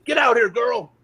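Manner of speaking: put-on high, loud holler for the girl; hollering old man. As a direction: call her out of the trailer like a rough old man. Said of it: "he goes".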